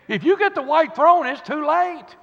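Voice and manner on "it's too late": ordinary delivery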